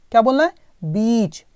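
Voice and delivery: ordinary talk, nothing marked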